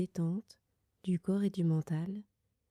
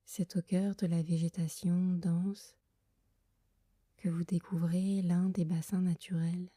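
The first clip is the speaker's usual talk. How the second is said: guided meditation. Acted. Leads a Yoga Nidra meditation. (voix douce et lente de méditation guidée)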